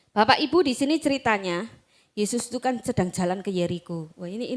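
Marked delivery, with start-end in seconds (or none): none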